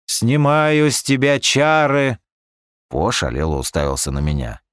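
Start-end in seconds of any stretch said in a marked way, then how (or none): 0.1-2.2 slow, hollow voice from beyond the grave; sepulchral voice. Acted. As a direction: intone it like a spirit from the grave. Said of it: "загробным голосом произнёс".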